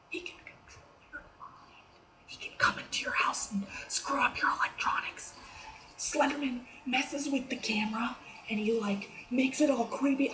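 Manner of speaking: hushed, scared whisper. Acted. Vlogger whispering so Slender Man can't hear. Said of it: "I'm whispering because he can hear me".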